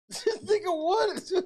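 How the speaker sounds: high, giggly incredulous question; laughing disbelief. Real, not acted. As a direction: laugh right through the question. Cracks up at the replay.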